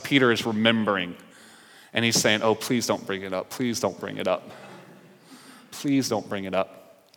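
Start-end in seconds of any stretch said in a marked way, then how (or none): 2.4-7.1 soft, low begging; pleading murmur. Retold or imitated. Peter silently begging Jesus, soft pleading. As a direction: plead under the breath. Peter's anxious inner plea.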